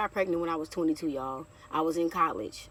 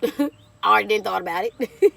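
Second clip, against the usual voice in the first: loud, higher outburst with laughs; emphatic inner retort; 4 semitones higher, 11 dB louder than usual. Retold or imitated. Telling viewers what she thought after the boss's plea, loud and laughing. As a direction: shout the private thought, amused. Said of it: "In my head, I'm like".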